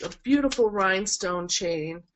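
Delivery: ordinary talk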